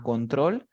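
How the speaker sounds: "control" drawn out slowly, stressed on TROL; slow con-TROL, weight on the end; final-syllable stress. Shown as a demonstration. Teacher demonstrates for student, slow "control" stressed on TROL. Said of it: "La fuerza de voz está al final".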